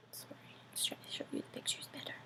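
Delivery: whisper. Real, not acted. Aside to audience mid-reading.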